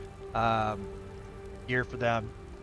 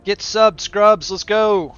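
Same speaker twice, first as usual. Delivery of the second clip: loud, higher hype shout to chat; hype call; 5 semitones higher, 6 dB louder, much slower than usual. Real, not acted. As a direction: pump up the crowd. Hyping chat to subscribe.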